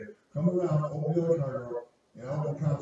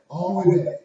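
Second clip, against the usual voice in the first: louder and higher, hitting "All the way back" hard; emphatic repeat; 7 semitones higher, 12 dB louder than usual. Retold or imitated. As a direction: stress the repeat, pushing "way".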